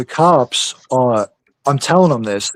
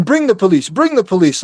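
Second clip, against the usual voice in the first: mock arrogant wife, a bit higher; higher, imitating the wife's arrogant taunt; 6 semitones higher than usual. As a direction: taunt smugly, imitating her. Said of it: "like, all arrogant".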